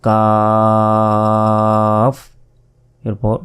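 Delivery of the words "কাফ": কাফ held long, tongue a little forward; টেনে কাফ; Arabic kaf drawn out. Shown as a demonstration. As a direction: stretch কাফ slowly. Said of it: "কাফ অক্ষরটি উচ্চারণ হয়".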